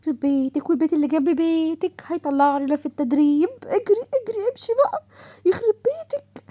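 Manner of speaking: put-on frightened girl fleeing the new officers; panicked whining. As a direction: beg them to run, almost crying.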